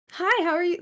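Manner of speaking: high, bright customer service greeting; chirpy front desk hello. Shown as a demonstration. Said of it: "my voice goes so high".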